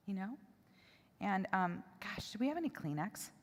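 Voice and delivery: very soft, choked-up aside; hushed and close to crying; tearful. Real, not acted. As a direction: speak quietly while holding back real tears. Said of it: "I'm gonna cry every time".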